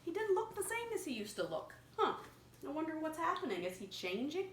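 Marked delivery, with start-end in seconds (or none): none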